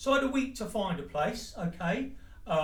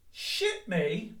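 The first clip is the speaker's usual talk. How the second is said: normal talking voice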